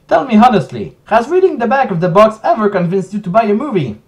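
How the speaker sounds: hammed-up insulting rant, voice pushed up; dramatic reading. Reading the DVD box insults aloud, loud hammed-up rant. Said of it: "Let's do a dramatic reading".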